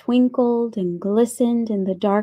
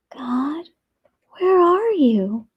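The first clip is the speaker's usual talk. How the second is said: put-on child's searching inner voice; hushed call lifting into a high question; whispered thought; 5 semitones higher, slower than usual. A narrator's reading. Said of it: "Nia whispered in her head".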